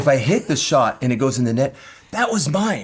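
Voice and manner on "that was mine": higher-pitched imitation of a complaint; mock indignant. Husband imitating partner after a missed shot, mock indignant. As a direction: mimic a partner claiming the ball.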